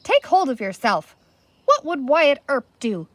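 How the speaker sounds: steady him with a firm voice. A narrator's reading. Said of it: "said Pamela firmly".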